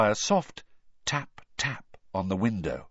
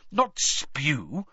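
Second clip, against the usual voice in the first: impatient; 5 semitones higher than usual. A narrator's reading. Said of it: "said Hermione impatiently".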